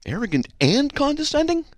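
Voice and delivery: high-pitched incredulous echo; affronted question, far up in pitch; mock disbelief. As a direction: squeal it back as if stunned. Feigns disbelief at the insult.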